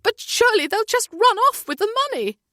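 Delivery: panicked mother close to a scream; frantic near shriek; almost screaming. A narrator's reading. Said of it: "She's almost screaming now".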